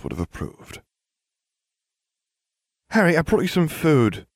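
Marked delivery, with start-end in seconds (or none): 2.9-4.3 high-voiced girl calling; lightly voiced, high call. A narrator's reading. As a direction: call brightly to a friend in another room. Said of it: "Hermione called".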